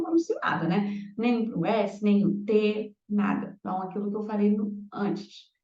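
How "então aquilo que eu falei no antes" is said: usual speaking voice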